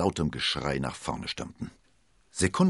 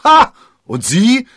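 scornful retort; 17 dB louder, slower than usual. Acted. Athos retorts to the commander.

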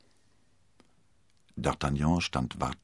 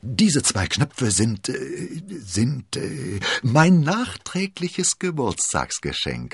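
higher, slow, halting squirm; stammering excuse; 7 semitones higher, 4 dB louder, slower than usual. A narrator's reading. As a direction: stall on the words as you grope for an excuse. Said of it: "stotterte er".

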